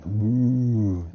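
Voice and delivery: long, loud drawn-out boo; mock booing from the stands; crowd boo. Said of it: "Most of the crowd are booing".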